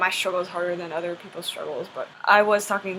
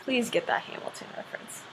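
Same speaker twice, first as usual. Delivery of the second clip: a pleading whisper to the audience; whispering, begging them to get the joke; whispered aside; 11 dB quieter than usual. Real, not acted. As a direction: breathe it as a quick aside, no voice. Aside begging viewers to notice.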